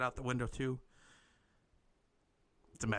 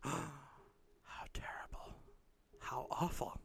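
whispered, slow and hushed; 8 dB quieter, much slower than usual. Acted. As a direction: breathe it out in shock. Reacts in shock to missing child.

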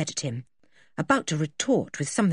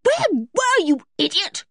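high, loud, angry scolding; outraged high-pitched demand; furious cry; 13 semitones higher, 3 dB louder than usual. A narrator's reading. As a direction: yell angrily at the dog. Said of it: "cried George furiously".

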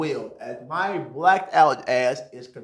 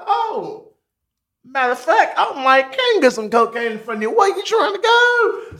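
high, loud, eager offer; hyped up and leaning in; pitched-up excited pitch; eager excitement; 13 semitones higher, 6 dB louder than usual. Retold or imitated. Excited offer to get cocaine.

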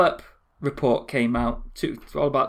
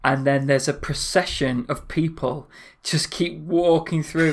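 ordinary talk, nothing marked

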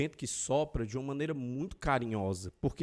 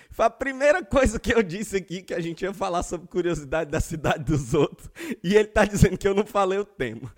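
louder, laughing through the jab; laughing teasing; 7 dB louder than usual. Real, not acted. Host laughing at co-host who says he skipped the topic. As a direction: rib her while cracking up.

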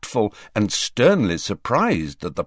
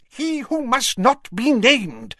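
snarled. A narrator's reading. Fudge snapping at the Prime Minister in a high snarl. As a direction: spit the name out as a warning. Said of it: "snarled Fudge".

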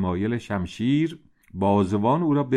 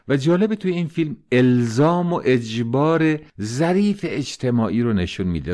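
ordinary delivery, nothing marked

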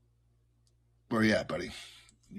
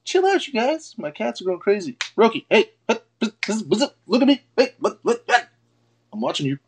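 high, loud mock scolding of the cats; mock owner scolding cats; 9 semitones higher, 3 dB louder than usual. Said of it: "you're all".

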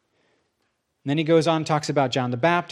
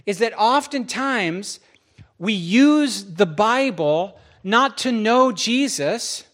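slow, louder pointed sermon; earnest preaching; 5 semitones higher, 4 dB louder, slower than usual. Acted. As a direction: press the point home, slowly. Preaching with force.

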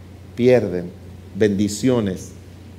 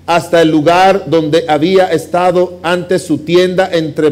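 louder, driving pulpit voice; fervent preaching; 4 semitones higher, 6 dB louder than usual. Acted. Preacher booming Lot's story to the congregation, fervent. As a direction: preach it out to the whole church.

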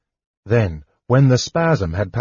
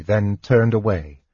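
ordinary delivery

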